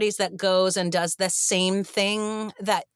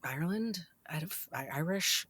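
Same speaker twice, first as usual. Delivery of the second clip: very soft, hesitant retold query; timid questioning; 4 semitones lower, 12 dB quieter than usual. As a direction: venture it meekly, barely voiced. Cast timidly questioning Walken, retold.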